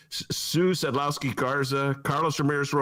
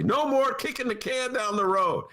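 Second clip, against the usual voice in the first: quoting Rahm; 12 semitones higher than usual. Retold or imitated. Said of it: "something Rahm always used to say".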